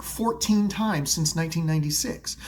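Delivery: ordinary talk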